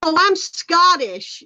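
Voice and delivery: a hint of a naive American claiming roots; mock American tourist. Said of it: "they say".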